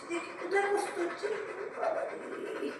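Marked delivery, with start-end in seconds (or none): 1.8-2.7 whispered. Real, not acted. Whispered sympathy to pet.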